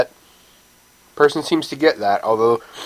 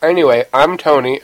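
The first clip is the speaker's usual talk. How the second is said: ordinary talk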